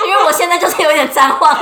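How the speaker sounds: usual speaking voice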